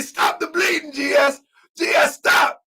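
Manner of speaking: high, loud, half-crying plea; mock frantic shout. Imitating Kadar begging GS to stop, high shouting. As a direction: shout it like someone is bleeding out. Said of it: "Kadar say".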